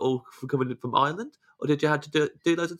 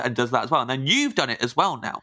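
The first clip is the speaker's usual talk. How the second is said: usual speaking voice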